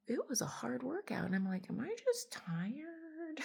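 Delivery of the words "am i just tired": high, slow puzzled self-question; wondering if just tired. Retold or imitated. To herself mid-workout, high slow puzzled question. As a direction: ask it like a bewildered gym-goer, drawn out.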